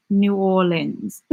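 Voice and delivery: "New Orleans" slowed down, as "noo OR-linz"; careful two-syllable "Orleans" in "New Orleans", with an r; corrected pronunciation. Shown as a demonstration. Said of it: "That's wrong".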